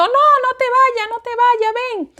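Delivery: high, slow, frantic begging; high anguished plea, slowed at the start; desperate pleading. Retold or imitated. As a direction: cry out to husband and child to stay. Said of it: "diciendo el nombre de mi esposo".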